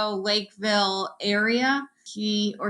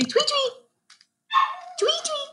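high chirpy tweets; bird tweet imitation. Shown as a demonstration.

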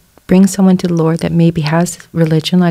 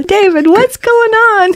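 very high-pitched calling out; re-enacted alarmed mom. Mom calling after son halfway up the street, re-enacted alarm. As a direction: act out a mother calling after her child.

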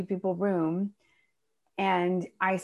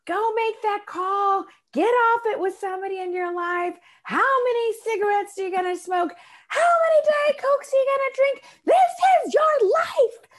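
forum leader shouting; 14 semitones higher, 5 dB louder than usual. Retold or imitated. Seminar leader pushing the room at the break, building to a shout. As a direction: whip the room up like a fired-up seminar leader, rising to a yell. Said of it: "the forum leader's like".

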